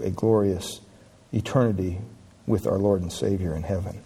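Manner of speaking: hushed invitation to trust Christ; pleading altar call. Acted. Quiet altar appeal to listeners.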